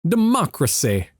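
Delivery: put-on Southern accent, a Foghorn Leghorn send-up; mock Southern drawl. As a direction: play a cartoon Southern rooster for laughs. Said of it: "that became Foghorn Leghorn for a second".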